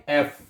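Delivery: एफ said "eff", as in English; letter F unchanged. Shown as a demonstration. Teacher answers own question for students, clearly pronounced. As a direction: say एफ as a plain "eff". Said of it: "एफ को आपने एफ ही पढ़ना है".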